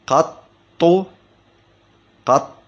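قط split as qat, pause, tu; doubled ط with damma. Shown as a demonstration. Teacher showing the standard form, قط as qat-tu in two parts. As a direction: break قط in two, ending on a short u. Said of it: "وضمها".

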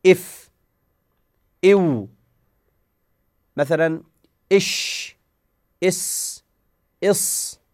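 each letter said with a short vowel and held friction; إف، إو، إش، إس، إص said with the consonant hissed out, no bounce; friction letter sounds. Shown as a demonstration. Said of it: "بالاحتكاك". (أصوات احتكاكية ممدودة)

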